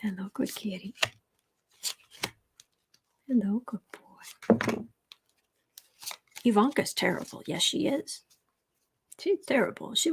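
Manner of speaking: soft greeting to a cat; quiet, affectionate pet talk; cooing to pet. Real, not acted. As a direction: coo softly to the kitty. Talking to the cat.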